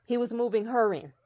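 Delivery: ordinary delivery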